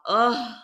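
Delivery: loud, high "ugh"; loud burst of real disgust; disgusted groan. Real, not acted. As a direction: groan out your exasperation. Disgusted at the character.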